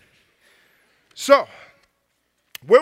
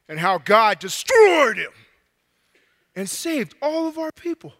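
building from hushed to a loud shout; dramatic storytelling dad; 3 dB louder than usual. Acted. Dad telling his kids about Pharaoh and Moses, building epic storytelling. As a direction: tell it like an epic to your kids.

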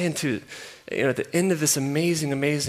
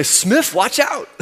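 booming high Southern drawl of a big loud coach; 10 semitones higher, 8 dB louder, slower than usual. Retold or imitated. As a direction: holler it like a Southern assistant coach. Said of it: "He had this super thick Southern accent".

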